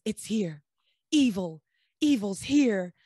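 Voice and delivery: a grave priest declaring evil; ominous priest. Retold or imitated. Priest's ominous warning, retold.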